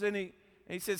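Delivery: ordinary talk, nothing marked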